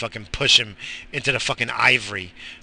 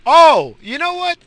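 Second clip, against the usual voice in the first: much higher and louder, burst of a new idea; sudden excitement; 11 dB louder than usual. Real, not acted. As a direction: jump on the thought. Sudden idea mid-rant.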